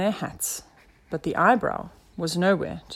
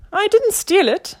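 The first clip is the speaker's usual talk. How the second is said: very high, loud little creature voice; indignant tiny caterpillar squeak; 14 semitones higher, 8 dB louder than usual. A narrator's reading. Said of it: "squeaked the eyebrow".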